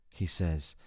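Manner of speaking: usual speaking voice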